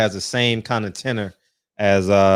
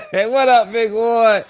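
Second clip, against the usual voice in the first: ordinary talk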